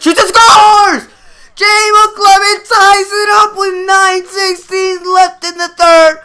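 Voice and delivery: high, loud, stretched roar on the goal; goal call shout. Real, not acted. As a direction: shout it as the building erupts.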